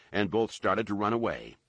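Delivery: plain reading voice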